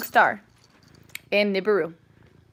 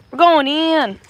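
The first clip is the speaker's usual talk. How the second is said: loud, higher playful announcement; rallying call; 4 semitones higher, 8 dB louder than usual. Pretend. Streamer announcing the move inside, mock-heroic call. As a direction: call out WE'RE GOING IN like a mission.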